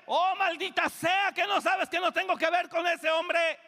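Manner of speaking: high, loud furious curse; cursing shout. Retold or imitated. Peter cursing to deny Jesus, high furious shout. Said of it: "Maldijo Pedro".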